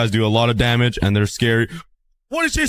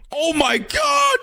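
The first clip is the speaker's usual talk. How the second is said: high, slow drawn-out yell; shocked shout; much slower than usual. Real, not acted. Player shouting after getting sniped by a mob. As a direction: stretch it out in disbelief.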